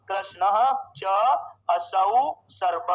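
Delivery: कृष्ण, च, असौ, सर्प slow and low, pauses between; separated word by word. Shown as a demonstration. Teacher splitting the compound for students, slow separated words. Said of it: "दूसरा विग्रह करो इसका".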